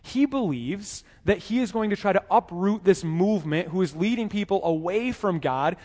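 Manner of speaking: ordinary delivery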